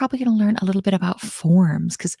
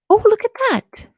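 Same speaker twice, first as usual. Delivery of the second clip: high, loud exclamation of surprise; pleasant surprise; 14 semitones higher, 7 dB louder than usual. Real, not acted. Teacher to class as the audio appears, surprised. Sees her own code working.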